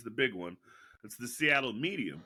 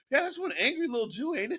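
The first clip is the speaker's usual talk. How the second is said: mocking heckler voice; 12 semitones higher than usual. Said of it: "then somebody goes".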